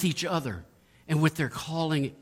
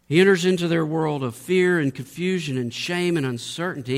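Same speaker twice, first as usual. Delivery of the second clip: ordinary talk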